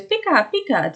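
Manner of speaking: high-pitched cartoon Pikachu cry; high Pikachu impression; Pikachu call. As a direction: squeak it out like the cartoon creature. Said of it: "And Pikachu goes".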